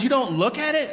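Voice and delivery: high, disbelieving question; incredulous wife. Retold or imitated. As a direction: ask in stunned disbelief.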